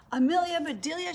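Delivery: high-pitched exclamation of a name; dismayed cry. A narrator's reading. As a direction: cry out the maid's name, aghast. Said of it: "she cried".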